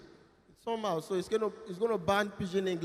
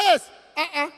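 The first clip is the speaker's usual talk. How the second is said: mock shout; 6 semitones higher, 4 dB louder than usual. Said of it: "You see somebody come shout".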